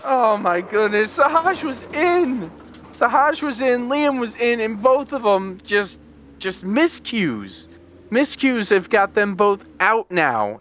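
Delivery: can't believe what just happened; shocked disbelief. Real, not acted. Both fast drivers crashed out.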